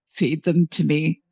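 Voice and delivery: lightly voiced man pronouncing his own penance; solemn manager. A narrator's reading. Manager tells customer how to punish him, solemn.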